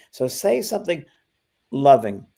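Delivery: usual speaking voice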